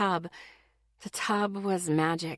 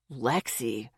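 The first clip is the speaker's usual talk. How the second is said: much lower, soft pained groan of her name; 7 semitones lower, 5 dB quieter than usual. A narrator's reading. Boyfriend pained by her teasing, low and soft. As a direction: sigh her name like it hurts. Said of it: "His voice sounded pained".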